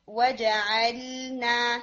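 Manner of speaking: slow وَجَعَلْنَا, every letter given time; unhurried. Shown as a demonstration. Teacher showing the student slow, even letters. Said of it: "time دیں ہر letter کو".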